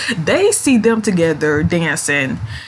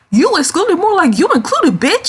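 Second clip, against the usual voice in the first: sassy comeback; 11 semitones higher than usual. Pretend. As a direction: snap it back at him with full sass. Her own sassy comeback to Stud.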